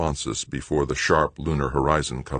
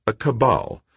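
light, lifted pitch falling away; mildly playful correction; light answer; 8 semitones higher, 3 dB louder than usual. A narrator's reading. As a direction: toss it off lightly. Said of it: "Doug answered lightly".

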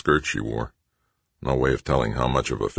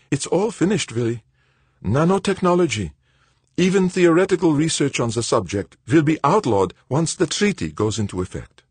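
put-on higher, quicker voice for the anxious old friend; Verban, worried old colleague; 12 semitones higher than usual. A narrator's reading. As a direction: voice Verban as tense and urgent. Verban's voice in the read story.

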